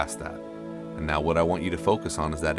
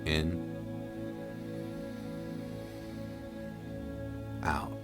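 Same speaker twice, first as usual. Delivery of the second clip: guided breathing; 7 dB quieter than usual. Acted. Guides slow breathing at start.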